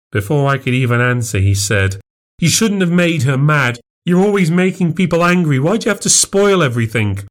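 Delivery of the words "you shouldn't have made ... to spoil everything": put-on upset child. Liam blames his mum.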